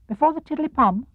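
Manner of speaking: a timid tiny piglet asking. Acted. Piglet questions Pooh about the hum, squeaky small voice.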